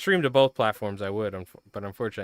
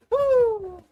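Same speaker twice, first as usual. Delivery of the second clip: a much higher cheering whoop; 4 dB louder than usual. Real, not acted. Streamer cheering the marble race finish, high whoop. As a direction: let out a celebratory woo.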